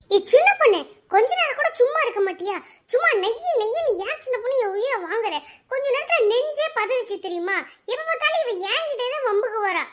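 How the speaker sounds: high-pitched cross complaint; worked-up, high-voiced telling-off; indignant scolding. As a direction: scold the friend who just scared you. Scolding friend after a scare.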